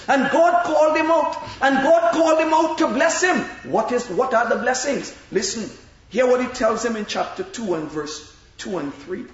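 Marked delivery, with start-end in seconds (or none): 0.1-3.4 loud, emphatic sermon build; preaching. Acted. Preacher asking the congregation why God chose Abram. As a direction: drive the question home to the saints.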